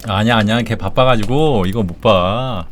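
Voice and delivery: ordinary talk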